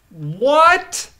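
a loud, high, disbelieving "What?"; shocked what. Real, not acted. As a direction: blurt it out in outraged disbelief. Outraged at fast fingerprint report.

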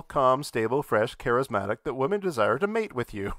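ordinary delivery

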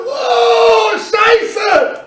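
high drawn-out "oh" then a curse; re-enacted yelp at a freezing touch; pained cold shock. Lifter grabbing an ice-cold dumbbell, pained yelp.

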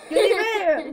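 high, loud delighted cry; excited. Real, not acted. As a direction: cheer out the good flavour. Relieved, got the good flavour.

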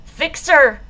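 loud, high, forceful order; angry demand. A narrator's reading. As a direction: bark it out, demanding. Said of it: "says Uriah".

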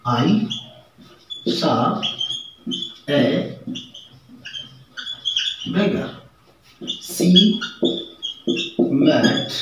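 slow, spaced word by word; example sentences. Shown as a demonstration. Teacher dictating example sentences to the class, word by word. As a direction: dictate each word with long pauses. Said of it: "जैसे".

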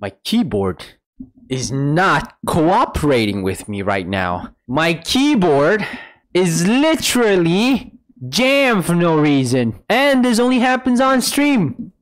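slow, drawn-out emphatic complaint; exasperated. Real, not acted. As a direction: stretch each word in frustration, rising as it goes. Complains about jammed keyboard, word by word.

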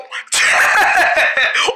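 big giggling fit at a silly voice; burst of laughter. Acted. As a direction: crack up laughing. Laughing at the funny voice.

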